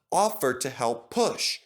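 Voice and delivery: usual speaking voice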